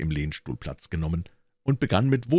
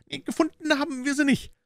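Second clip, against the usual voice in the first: put-on much higher, cheeky boy's voice; street urchin. A narrator's reading. Wiggins answering Holmes as a cheeky urchin. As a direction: pitch it up like a ragged street kid.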